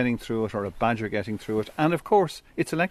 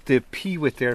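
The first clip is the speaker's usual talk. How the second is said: ordinary talk, nothing marked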